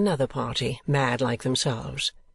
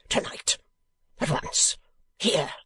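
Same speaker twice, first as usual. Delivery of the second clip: put-on a drunk man hissing his outrage, then crying out; outraged demand; 2 semitones higher, slower than usual. A narrator's reading. Sir Mulberry to the crowd after the blow, strained fury.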